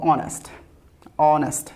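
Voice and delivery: "honest" begun on an open O, no H; starts from O. Shown as a demonstration. Teacher modelling the word for learners, "honest" starting on O. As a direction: say "honest" from the O, leaving H silent. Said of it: "ও থেকে উচ্চারণ করব".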